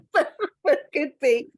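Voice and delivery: giggling through the words; laughing. Real, not acted. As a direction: laugh it out, amused. Laughing at traffic warden joke.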